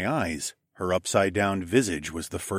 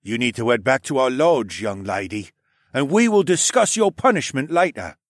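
stern, louder captain voice; 4 semitones higher, 5 dB louder than usual. A narrator's reading. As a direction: scold like a stern uncle. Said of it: "in his deep voice".

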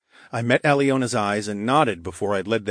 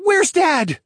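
put-on high, loud boyish question; a lynx kid asking urgently; Pratt; 7 dB louder than usual. A narrator's reading. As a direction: call it out in a high child's voice. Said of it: "Pratt asked".

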